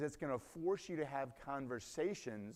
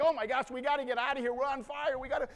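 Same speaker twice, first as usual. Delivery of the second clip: higher, louder, fast frantic cries; hysterical; 13 semitones higher, 10 dB louder than usual. Retold or imitated. Wife stuck in her seatbelt, hysterical fast cries. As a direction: babble in blind panic. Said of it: "she's hysterical".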